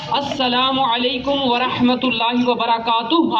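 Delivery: السلام علیکم ورحمۃ اللہ وبرکاتہ said slowly and fully; a slow, complete model salam; full pronunciation. Shown as a demonstration. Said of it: "آپ بھی تلفظ ادا کیجیے گا پورا".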